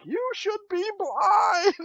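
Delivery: slow, high drawn-out mock wail; mom warning. Mom scolding the boys after the whiskey, slow high mock wail. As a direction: stretch it like a tearful mother. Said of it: "she was just like".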